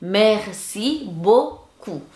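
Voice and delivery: very slow, syllable by syllable; spaced-out teaching demo; slow model pronunciation. Shown as a demonstration. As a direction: draw out each syllable for learners. Teacher models phrase slowly.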